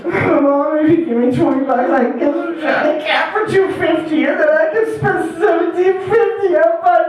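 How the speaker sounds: pleading that climbs high into a frantic pitch; desperate plea. Acted. Addict son pitches mom a deal, desperate. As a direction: argue the case with mounting desperation. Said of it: "the big desperation".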